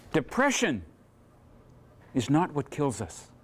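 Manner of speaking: ordinary talk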